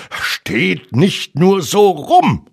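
high, slow, irritated scolding; grumpy barked order. A narrator's reading. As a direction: snap crossly at idle friends. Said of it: "blaffte Rabbit".

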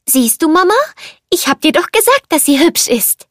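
high-pitched, eager kiddie voice; little child. A narrator's reading. Little cup tells his teapot mother, high childlike voice. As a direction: pipe up proudly like a small child.

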